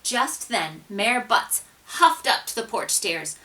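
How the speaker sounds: plain reading voice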